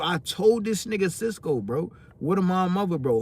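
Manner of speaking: usual speaking voice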